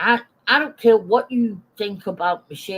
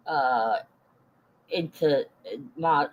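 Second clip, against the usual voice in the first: ordinary talk, nothing marked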